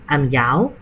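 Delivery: normal talking voice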